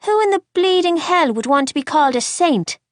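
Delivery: high, loud Irish protest; outraged Irish accent. A narrator's reading. Boy balks at being called Saint, outraged Irish accent. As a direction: exclaim in disgust as an Irish boy. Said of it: "thick Irish accent of his".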